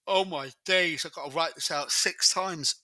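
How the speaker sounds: talking through a yawn; words stretched by a yawn. Real, not acted. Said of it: "sorry about the yawn".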